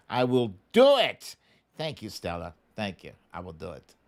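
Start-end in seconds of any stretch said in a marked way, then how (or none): 0.1-1.4 belted out, loud and firm; a loud, emphatic vow; loud promise. Real, not acted. 3.3-3.9 much softer, low and under the breath; a soft, murmured echo of the vow; quiet repeat. Real, not acted.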